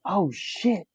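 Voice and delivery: sudden high "oh, shit" of pleased shock; surprised exclaim. Retold or imitated. Fan learning a Nebraska player is from Mississippi, high surprised burst. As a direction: relive the moment the news hit you. Retold reaction to surprising news.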